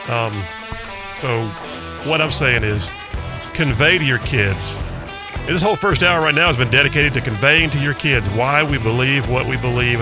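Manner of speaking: normal talking voice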